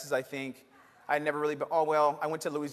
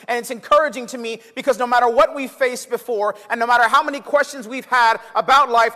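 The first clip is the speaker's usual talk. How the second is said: louder, higher preaching voice, rolling phrases; full-force preaching; 6 semitones higher, 9 dB louder than usual. Acted. Pastor rousing the church about uncertainty, full-force preaching.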